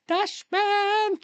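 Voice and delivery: high, drawn-out call; hammed-up exclamation; comic outburst. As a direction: stretch it out, very high.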